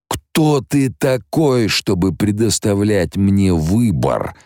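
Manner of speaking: a scornful old tyrant mocking the boy; old Harkonnen. Baron Harkonnen through Alia scorning Leto's choice. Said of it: "голосом старого Харконнена".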